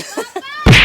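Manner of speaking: high gasping laugh, out of breath; breathless laugh. Real, not acted. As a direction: laugh between pants. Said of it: "Hiningal kami". (tawang hingal)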